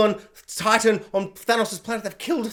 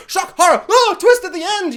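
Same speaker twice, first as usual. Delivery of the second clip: much higher and louder, gasping; hammed-up B-movie scare; mock horror shock; 10 semitones higher, 5 dB louder than usual. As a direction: ham up the twist.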